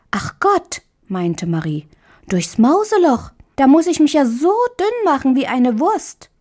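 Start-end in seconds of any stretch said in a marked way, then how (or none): 0.1-0.8 put-on much higher startled little girl; dismayed girl. A narrator's reading. 2.3-6.3 put-on much higher, childlike complaint; a girl aghast at squeezing through; dismayed little girl. A narrator's reading. Marie protests to the mouse, much higher childish dismay.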